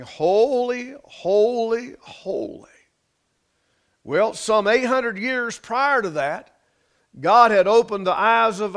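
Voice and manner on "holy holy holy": retold crying out. Preacher voicing winged seraphims in heaven, retold crying out. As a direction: lightly cry it out, last word falling away. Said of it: "and they are crying".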